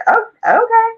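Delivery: high squeaky mock surprise; surprised oh okay. Retelling her reaction to the cousin, high mock surprise. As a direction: act caught off guard, voice jumping up. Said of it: "And I was like".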